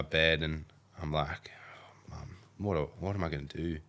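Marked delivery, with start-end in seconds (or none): 2.1-3.8 hushed, breathy plea to mum; small whispered worry; whispered question. Retold or imitated. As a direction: whisper it like a lost kid at night. Lying in bed, asking mum.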